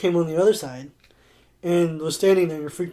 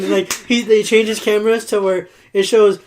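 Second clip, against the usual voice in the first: ordinary talk, nothing marked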